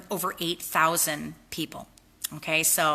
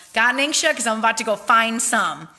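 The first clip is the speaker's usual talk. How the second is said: excited sign-off; 5 semitones higher, 7 dB louder than usual. Real, not acted. Playful upbeat sign-off.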